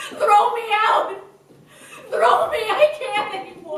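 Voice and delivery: loud, high, desperate cry to be thrown out; anguished outburst. Acted. Despairing sister cries out to her family, loud and high. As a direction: break down and beg at full voice. Irina breaks down, begs.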